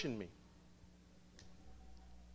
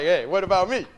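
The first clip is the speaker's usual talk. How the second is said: mock offense; 6 semitones higher, 9 dB louder than usual.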